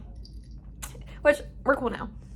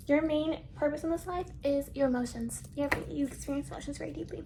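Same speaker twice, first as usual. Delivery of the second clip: softer, mock-solemn astrologer; mystic reader; 2 semitones higher, 8 dB quieter than usual. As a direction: read it like a horoscope. Quoting chart readings, softer.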